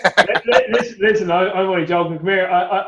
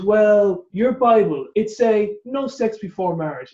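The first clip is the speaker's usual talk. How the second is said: slow, slightly higher broken English, lightly done; imitating broken English; 4 semitones higher, slower than usual. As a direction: mimic a Korean friend's halting English, slowly. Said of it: "In his broken English".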